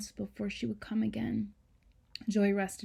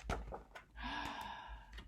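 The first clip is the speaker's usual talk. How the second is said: soft gasp; 6 semitones higher, 13 dB quieter than usual. Real, not acted. Reacting to a picture.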